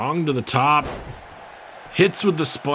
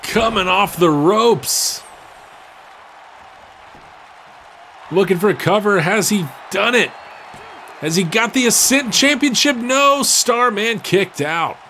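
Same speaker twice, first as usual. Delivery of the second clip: excited play-by-play; 8 semitones higher than usual. Real, not acted. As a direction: build the hype on the near fall. Near fall called live.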